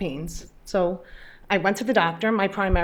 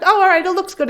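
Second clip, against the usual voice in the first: high, louder, breezy doctor voice; imitating upbeat doctor; 11 semitones higher, 7 dB louder than usual. Patient mimics the oncologist's cheerful first glance, high and loud. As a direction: chirp that it all looks fine.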